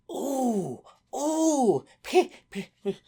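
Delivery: high, loud mock-excited oohs. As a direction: stammer with childlike excitement. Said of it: "You would see the adults going".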